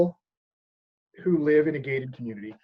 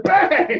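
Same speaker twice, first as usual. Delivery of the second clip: mock bang; 12 semitones higher, 8 dB louder than usual. Mimicking bear banger going off.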